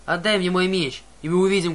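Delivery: loud, defiant shout; shouting challenge. Acted. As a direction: yell the dare at the monster. Clarisse demanding her sword.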